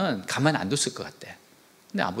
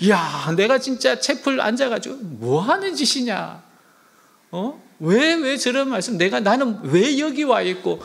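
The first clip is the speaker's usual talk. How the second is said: shocked outburst; 8 semitones higher, 4 dB louder than usual. Retold or imitated. Soldier's shocked self-questioning.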